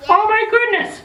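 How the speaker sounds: high, squealed oh my goodness; mock amazement. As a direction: act bowled over by all that gear. Hockey players' heavy gear.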